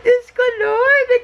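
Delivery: real overjoyed plea; tearful overjoyed cry. Real, not acted. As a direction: cry out to the heavens in joy. Overwhelmed by Mikey's comment.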